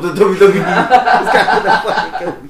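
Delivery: words breaking up with laughter; loud, high, helpless laughing delivery. Real, not acted. Friend mocking a marriage described as wrestling, laughing.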